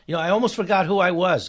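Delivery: normal talking voice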